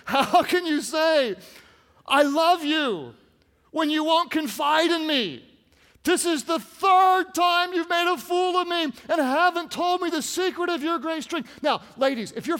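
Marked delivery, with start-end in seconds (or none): 0.0-1.3 loud, slow, pitched-up man's voice; man voice for Delilah. Acted. Preacher reading Delilah's line in man voice, loud complaint. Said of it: "Let's just stick with the man voice". 2.1-11.5 loud, high, wounded tirade; man voice for Delilah. Acted. Preacher as Delilah scolding Samson, aggrieved and loud. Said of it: "Let's just stick with the man voice".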